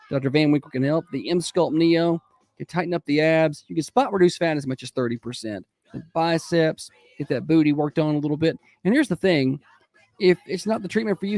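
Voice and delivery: upbeat morning radio host read; radio announcer. Acted. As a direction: sell it like a live radio spot. Radio host opening and ad reads.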